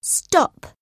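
sudden high-pitched command; sharp order. A narrator's reading. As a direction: order it to halt, pitch leaping up. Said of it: "ordered Eldred".

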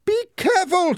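very high, loud scream of warning; panicked, piercing cry. A narrator's reading. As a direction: shriek a frantic warning. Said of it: "screamed Margot".

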